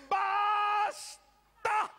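long, high bellow; drawn-out, high-pitched yell; shouted command. Acted. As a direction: stretch it into a huge shout for silence. Cuts off the uproar.

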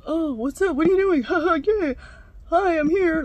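loud, high-pitched mock greeting; boyfriend's dad. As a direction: imitate an oblivious, booming dad barging in. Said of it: "his dad's like".

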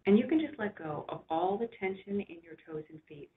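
unhurried relaxation voice with long pauses; guided meditation. Real, not acted. Doctor guiding listeners to rest back and relax, soothing guided imagery.

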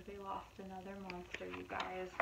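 ordinary delivery, nothing marked

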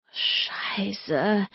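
soft, whispery groan; groaned curse. A narrator's reading. Narrator waking from a dream and getting up, groaning softly. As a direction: groan the swear word out under your breath. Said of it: "stöhnte ich".

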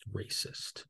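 a dry, breathy whisper dropped at the end of the list; whispered aside. Acted. Reading the poll results to viewers, drops the last option as a sly whisper.

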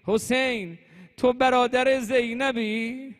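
high, loud lamenting call; imitated cry of a grieving sister; retold anguished cry. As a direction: cry out to a brother, drawn out. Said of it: "فریاد زد".

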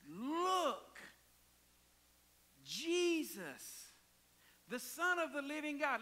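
preacher hollering that the Son has come; hollered announcement. Retold or imitated. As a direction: cry it out to the crowd. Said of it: "he hollers and tells everybody".

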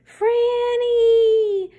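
high, loud, drawn-out screech of the name; screaming raccoon. A narrator's reading. Raccoon screaming at Francine on the roof, high drawn-out screech. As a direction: shriek it long and high like a wild animal. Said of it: "screamed the raccoon".

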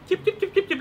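quick repeated bird chirps; imitated birdsong. Storyteller adds sounds of a new morning, imitated birdsong. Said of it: "มีเสียงนก".

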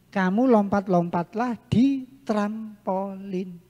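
ordinary talk

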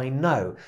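"I know" with silent k, like "no"; the k in "know" is not spoken. Shown as a demonstration. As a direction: say "know" exactly like "no". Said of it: "bo to jest K nieme".

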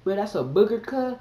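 high, loud astonished question; can't believe what he sees; incredulous. Retold or imitated. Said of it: "I was like".

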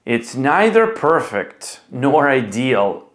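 normal talking voice

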